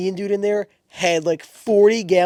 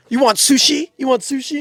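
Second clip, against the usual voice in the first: high mocking voice, much higher; mocking imitation; 10 semitones higher, 6 dB louder than usual. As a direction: parody a condescending clerk. Mocking a racist greeting.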